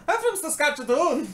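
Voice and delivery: high, squeaky put-on voice; hammed-up high voice on a big guy; muscleman from Grown Ups. Imitating a muscular guy by the pool, silly high voice. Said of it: "he's like in Grown Ups when".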